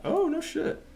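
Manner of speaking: surprised. Real, not acted. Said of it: "surprised reaction".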